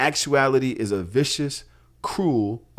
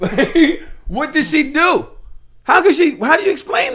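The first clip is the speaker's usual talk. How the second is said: rising, louder disbelieving questions; higher and louder, baffled; incredulous outburst; 10 semitones higher, 6 dB louder than usual. Retold or imitated. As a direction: climb in pitch with each question. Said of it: "I'm like".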